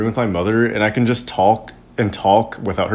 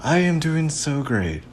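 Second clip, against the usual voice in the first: slow, higher, drawn-out false cheer; softer, higher, insincere reassurance; put-on positivity; 5 semitones higher, 5 dB quieter, slower than usual. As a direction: stretch out the pretend happy answer. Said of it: "I usually say".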